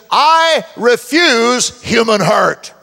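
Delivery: loud, slow declaration; quoting a mentor boldly. Retold or imitated. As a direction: plant each word firmly. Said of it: "He said".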